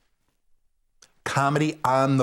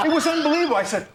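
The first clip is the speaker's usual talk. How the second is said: usual speaking voice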